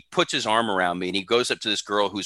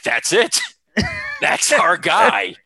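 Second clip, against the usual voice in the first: slow, loud, higher boss voice; Vince; 7 semitones higher, 5 dB louder, much slower than usual. Retold or imitated. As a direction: drag the words out like a boss who has just made up his mind. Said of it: "And Vince is like".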